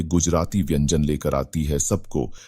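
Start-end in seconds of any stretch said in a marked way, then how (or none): none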